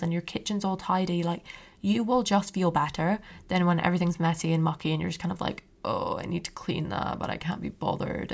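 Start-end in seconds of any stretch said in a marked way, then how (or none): 5.8-8.3 low, soft, lazy groan of reluctance; can't be bothered. Retold or imitated. Imitating her lazy inner voice putting off cleaning, low and soft. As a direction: sigh it out like you can't face the mess. Lazy self-talk about messy room.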